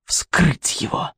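slow, breathless, unvoiced witch whisper; gloating whisper. A narrator's reading. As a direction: whisper it with evil relish. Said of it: "злорадно произнёс голос в темноте".

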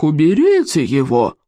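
slow, drawn-out mock roar; restrained bellowed order; roaring berserker. A narrator's reading. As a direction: stretch the command out like a warlord. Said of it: "взревел берсерк".

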